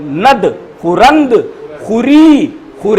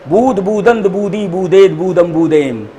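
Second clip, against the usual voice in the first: in one breath; 2 semitones lower than usual. Shown as a demonstration. Teacher showing students the table read in one breath. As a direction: run together without pauses, falling away. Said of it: "एक सांस में पहना".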